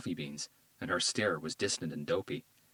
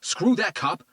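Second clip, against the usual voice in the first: higher, louder defiant shout; hostile shout; 10 semitones higher, 7 dB louder than usual. A narrator's reading. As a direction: spit it at the cop. Tito refuses cops.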